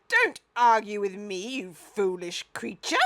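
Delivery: loud cross outburst; crying out crossly. A narrator's reading. The Duke cries out at the giraffe. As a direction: scold the creature. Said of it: "cried the Duke".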